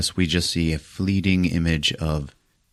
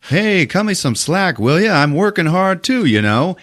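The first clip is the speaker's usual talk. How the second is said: higher, hammed-up whiny reply; mock crew member; 10 semitones higher than usual. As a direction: whine back like the slacking crewman. Crew member voiced in retold scene.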